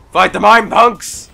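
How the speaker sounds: rally the team loudly; battle cry. Real, not acted. Gamer rallying teammates to fight the mind, yelling.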